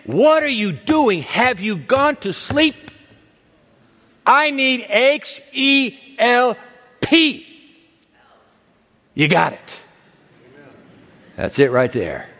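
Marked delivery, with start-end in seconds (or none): 0.0-7.5 high, drawn-out protest to God; pleading, insistent complaint; exasperated arguing. Acted. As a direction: argue it out word by word, pitched high. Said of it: "arguing till he's blue in the face".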